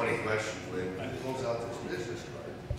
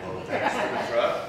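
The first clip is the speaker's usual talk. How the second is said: laughing sarcasm; 8 semitones higher, 6 dB louder, slower than usual. Retold or imitated. Sarcastic thanks to the inspector.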